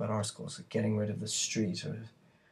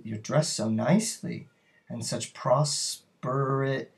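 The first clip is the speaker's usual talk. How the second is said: ordinary talk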